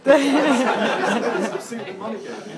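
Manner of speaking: laughing loud and high through the line; half-laughing, playing the innocent child. Child's reply retold, laughing.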